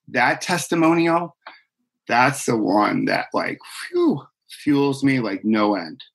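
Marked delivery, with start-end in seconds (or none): 3.9-4.2 high whistled phew. Real, not acted. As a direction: let out a high airy phew. Moved by clients' testimonials.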